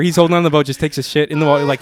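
ordinary delivery

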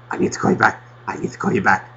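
hushed, breathy spy whisper; James Bond thing. Retold or imitated. Parent hanging up on a friend, hushed mock secret agent. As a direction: murmur it like a secret agent on a mission. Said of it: "the fucking James Bond thing".

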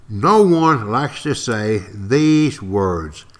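words drawn out slowly; slow it right down; slow and emphatic. Real, not acted.

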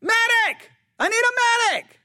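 high, loud desperate battlefield cry; medic shout. Shown as a demonstration. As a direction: yell for a medic across the field. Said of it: "just pretend you hear".

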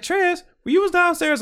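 high-pitched imitation of a little kid; little cousin. Little cousin asking an older relative a question, imitated high childlike voice. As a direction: put on a small child's high, curious voice. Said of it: "like, my little cousin".

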